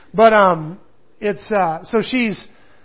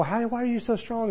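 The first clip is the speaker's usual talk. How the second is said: higher, softer imitation of coaxing; a hint of a wheedling Delilah, pitched up; lightly voiced Delilah; 4 semitones higher, 5 dB quieter than usual. Delilah pressing Samson for his secret, lightly imitated coaxing. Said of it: "Delilah had done the whole".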